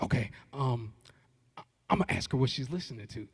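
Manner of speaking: whispered to himself, fast; hushed nervous self-talk; psyching himself up in a whisper; inner whisper. Retold or imitated. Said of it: "I said, in my head".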